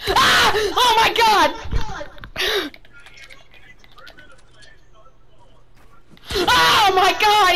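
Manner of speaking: real alarmed shout. Real, not acted. Gamer stunned by a comeback, high alarmed shouting. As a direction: yell it high, stretching the words. Screaming at a game event.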